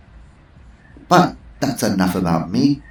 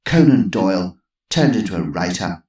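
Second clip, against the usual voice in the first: mild snort of contempt; scornful old lady; 4 semitones higher, 5 dB louder than usual. Acted. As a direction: dismiss the idiots. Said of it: "snorted Missus Twistleton".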